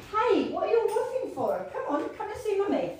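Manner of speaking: high sing-song voice for the dogs. Real, not acted. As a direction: coo at the dogs like a doting mum. Talking sweetly to her dogs.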